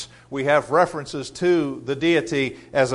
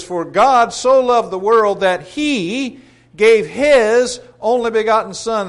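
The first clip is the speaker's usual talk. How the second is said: higher, louder, stretched-out Bible verse; emphatic scripture reading; 6 semitones higher, 7 dB louder, slower than usual. Acted. Preacher quoting the golden text to the congregation, emphatic scripture reading. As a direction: proclaim the verse with weight on key words.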